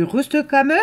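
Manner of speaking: loud, high mock German accent. Vlogger playing with the German word, high loud German accent. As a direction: throw it out as a question in a put-on German accent. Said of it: "ça se prononce avec un accent allemand".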